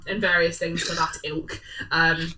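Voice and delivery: ordinary delivery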